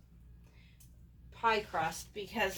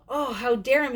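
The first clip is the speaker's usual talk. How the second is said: louder, higher mock outrage; retold anger; 4 semitones higher, 7 dB louder than usual. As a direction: act out being indignant at him. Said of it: "I was so angry".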